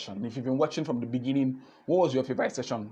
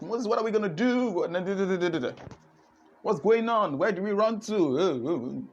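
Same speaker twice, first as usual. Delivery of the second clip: lightly voiced frantic crowd, pitched higher; panicked Israelites; 6 semitones higher than usual. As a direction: play the scared people begging Moses, a bit higher. Israelites voiced crying to Moses.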